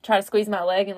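ordinary talk

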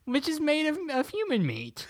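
a high, silly creepy twist; mock creepy aside. Joking in character.

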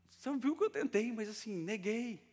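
high-pitched, squirming excuse; acted awkward confession in a high voice; sheepish admission. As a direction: plead your case with the voice pushed way up. Peter sheepishly admitting denial to Jesus.

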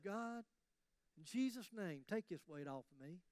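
pleading lightly, trailing off to a murmur; lazy prayer. Retold or imitated. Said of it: "go to the Lord every day".

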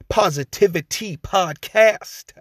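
big high announcer flourish; slow, showy ringmaster call. Acted. Corrected title, announcer flourish.

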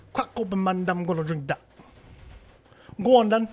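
high-pitched mock UK street accent; Jamaican-British accent. Friend daring his buddy to drink, high UK-Jamaican accent. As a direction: egg him on in a Jamaican Londoner voice. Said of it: "but I moved to the U K".

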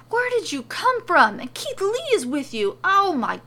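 high, loud, frantic amazement; overexcited theatrical shouting; astonished yelling. A narrator's reading. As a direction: yell as if you can't believe it. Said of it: "He splays his arms out and yells".

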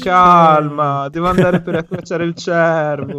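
very slow, unhurried brush-off; lazy dismissive drawl. Retold or imitated. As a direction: stretch it out like you can't be bothered. Imitating the unhurried ex-partner.

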